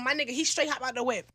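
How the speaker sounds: ordinary delivery, nothing marked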